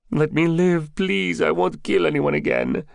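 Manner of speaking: high, desperate pleading; frightened high-pitched captive; begging for life. Acted.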